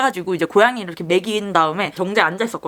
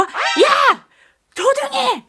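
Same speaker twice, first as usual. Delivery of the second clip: calling out; much slower than usual. Retold or imitated. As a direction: shout her nickname from afar. Boyfriend calling out from afar.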